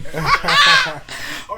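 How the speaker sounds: real delighted laugh; high loud laugh. Real, not acted. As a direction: burst of laughter at his own joke. Laughs at his own intro.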